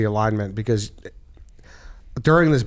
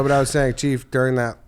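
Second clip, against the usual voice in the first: ordinary talk, nothing marked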